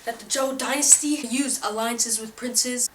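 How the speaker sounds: loud, pushy infomercial pitch; hard sell ad. Acted. Pitch continues loudly.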